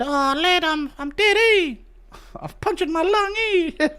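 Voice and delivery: very high, mock New Zealand voice; hammed-up New Zealander casually announcing his death; New Zealand accent. New Zealander announcing to you that they've died, high comic accent. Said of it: "Imagine a New Zealander announcing to you like they're dead".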